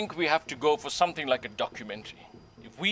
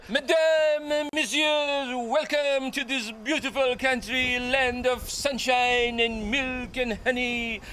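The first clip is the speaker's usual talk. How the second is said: slow, high, hammed-up French accent; grand French accent, stretched out like a host; 7 semitones higher, slower than usual. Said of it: "I can do a beautiful French accent".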